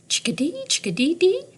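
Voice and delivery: high, rising chickadee dee; chickadee call. A narrator's reading. Teacher reading to class, calling like a chickadee. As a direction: chirp it like a chickadee. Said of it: "Chickadee is an acrobat".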